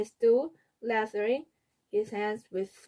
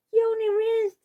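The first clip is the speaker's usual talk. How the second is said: put-on tattling toddler; 13 semitones higher, 5 dB louder than usual. A narrator's reading. Fudge tattling on Peter at the table, high child voice. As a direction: tell on him in a high child voice.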